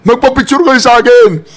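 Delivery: high, pleading mock plea from the president; mocking the president's plea for a wave; mock begging. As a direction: whine it like a beggar pleading for attention. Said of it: "Sabihin mo".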